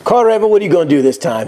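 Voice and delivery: normal talking voice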